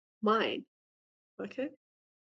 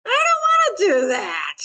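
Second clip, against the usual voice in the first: put-on high, louder whining little kid; whiny toddler; 7 semitones higher, 4 dB louder than usual. Teacher voicing the inner two-year-old, hammed-up whine. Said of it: "your two-year-old self".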